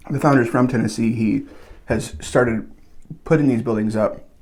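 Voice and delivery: ordinary delivery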